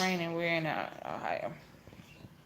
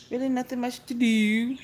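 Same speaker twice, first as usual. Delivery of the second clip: normal talking voice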